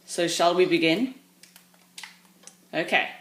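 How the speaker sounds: ordinary talk, nothing marked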